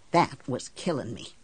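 usual speaking voice

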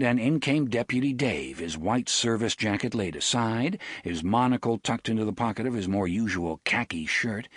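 plain reading voice

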